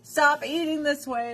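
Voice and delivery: high-pitched mock scolding; mock scream. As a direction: scold as the imagined nagging body. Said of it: "My body is screaming at me like".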